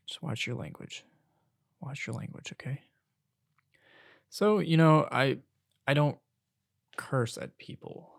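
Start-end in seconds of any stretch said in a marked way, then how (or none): 0.1-2.8 hushed retold reprimand; gentle warning. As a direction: quietly caution them, voice held down.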